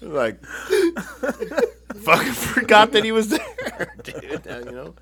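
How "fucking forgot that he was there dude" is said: high, slow words squeezed out through laughter; wheezing with laughter; laughing. Real, not acted. Recalling forgetting Jimmy, laughing high and loud. Laughing at his own story.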